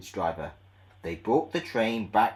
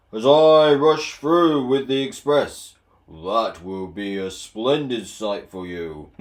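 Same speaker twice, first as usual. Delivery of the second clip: loud proud engine brag; boastful Gordon; 8 dB louder than usual. Acted. Proud engine boasting to little Edward. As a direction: brag grandly, chest out. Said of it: "he boasted".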